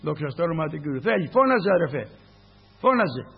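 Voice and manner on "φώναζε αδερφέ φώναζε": louder urging call; exhortation. Acted. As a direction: push the brother to cry out. Urges brother to cry out.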